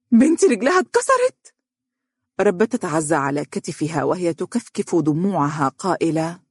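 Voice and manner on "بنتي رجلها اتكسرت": high, louder horrified question; shocked. Acted. Mother learning her daughter's leg broke, horrified. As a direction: cry it out in disbelief. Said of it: "وشهقت وهي تقول".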